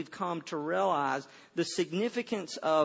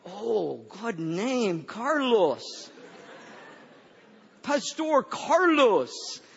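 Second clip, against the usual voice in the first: approving locals; 3 semitones higher, much slower than usual. Retold or imitated. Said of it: "And they would go".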